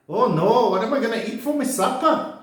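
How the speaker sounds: high-pitched, hammed-up Glaswegian dismay; mock Scottish accent. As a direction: wail it lightly like a Glasgow customer. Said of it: "my brilliant Scottish accent".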